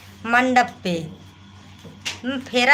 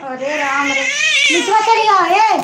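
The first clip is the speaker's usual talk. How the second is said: calling out; 8 semitones higher than usual. Real, not acted. Calling child down from above.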